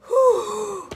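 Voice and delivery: long, high, loud whew; exhausted whew. Real, not acted. Cook finished with porridge workout, exhausted whew. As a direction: let out a big high puff of relief. Recovering after the whisking workout.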